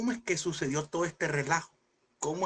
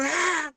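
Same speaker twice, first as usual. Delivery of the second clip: high, louder short cry of joy; mock joyful yelp; 12 semitones higher, 4 dB louder than usual. Congregant's shout of joy mimicked in the service. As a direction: imitate a worshipper's joyful shout. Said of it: "grito de júbilo".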